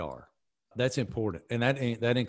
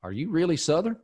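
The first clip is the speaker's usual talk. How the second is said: mock disbelief; 7 semitones higher than usual. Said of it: "y'all from the South are gonna be like".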